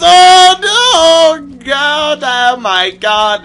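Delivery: high shocked shriek; panicked oh my God; startled scream. Real, not acted. Sudden shock in the game.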